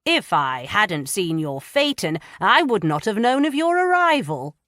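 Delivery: pompous and testy, rising loud. Acted. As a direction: complain like an offended magistrate. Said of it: "a testy edge to his voice".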